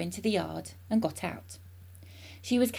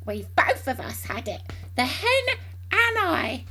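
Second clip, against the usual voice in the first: grandma calling out; 9 semitones higher than usual. A narrator's reading. Grandma telling Mary who had the medicine, shouting. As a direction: start firm, then climb high on the last words. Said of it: "Grandma shouted".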